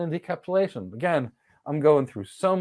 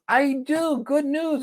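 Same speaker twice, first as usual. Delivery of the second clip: a lightly voiced cheery router, pitched higher; playful, higher-pitched router voice; 11 semitones higher, slower than usual. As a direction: chirp the good news like a pleased machine. Router happily finds a route.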